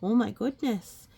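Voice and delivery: usual speaking voice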